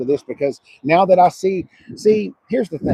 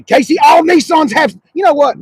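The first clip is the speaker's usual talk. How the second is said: heated shout; 11 semitones higher, 9 dB louder than usual. Real, not acted. Starts a heated correction.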